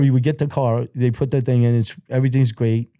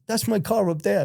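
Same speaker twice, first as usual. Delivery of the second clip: higher, quieter boast to friends; proud boast; 8 semitones higher, 4 dB quieter than usual. Retold or imitated. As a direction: show off the car up on the lift. Bragging to friends about car.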